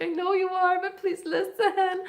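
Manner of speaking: high, loud plea with a laugh in it; playful pleading. Retold or imitated. Speaker begs upset guys to listen, playful pleading.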